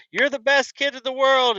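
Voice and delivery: high, loud mock praise to the kid; gushing parent. Proud parent gushing praise at the kid, high and loud. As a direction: gush over your kid like a proud parent.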